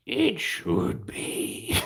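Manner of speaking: very drawn-out high whisper; mock creepy. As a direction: stretch it into an eerie high whisper. Creepy joke, stretched repeat.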